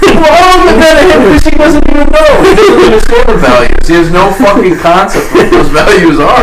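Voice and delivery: high, fast and loud, half laughing in disbelief; laughing exasperated rant about a clueless league-mate; worked-up rant. Real, not acted. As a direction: vent in amused outrage, racing.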